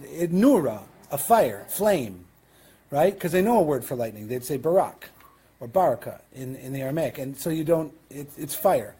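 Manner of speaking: usual speaking voice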